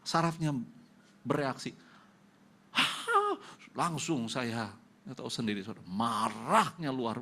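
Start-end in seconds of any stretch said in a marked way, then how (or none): 2.8-3.4 pained cry. Retold or imitated. Nerve pain while combing hair.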